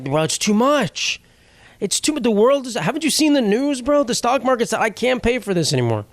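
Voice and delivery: high-pitched, exaggerated pleading; mock complaining. Customer calling to haggle over the bill, mock distraught. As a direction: plead hardship in a hammed-up high voice. Acted complaint call to company.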